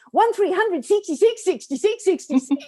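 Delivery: high, loud radio number in Kiwi vowels; New Zealand accent. Retold or imitated. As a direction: chant the digits in a broad New Zealand accent. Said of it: "my New Zealand accent".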